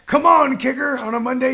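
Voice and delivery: root for the kicker out loud; cheering shout. Pretend. Cheering kicker on Monday night.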